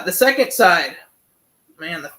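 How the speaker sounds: ordinary talk, nothing marked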